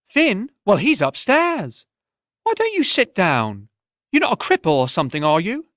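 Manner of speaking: a brassy woman demanding what's wrong with him; sharp tavern woman. Acted. Tavern woman speaks to boy.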